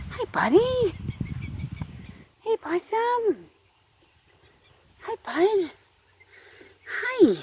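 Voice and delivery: high sweet voice for a pet chicken. Real, not acted. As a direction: greet the birds like babies, high and soft. Greets chickens in baby talk.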